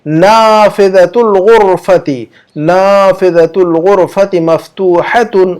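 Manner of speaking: नाफिदतुल गुरफती drawn out very slowly; slow model Arabic. Shown as a demonstration. Teacher slowly modelling नाफिदतुल गुरफती for the class. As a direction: dictate the Arabic slowly, word by word. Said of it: "उसकी अरबी यूं बनेगी".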